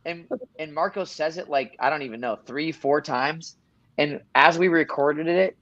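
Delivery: ordinary talk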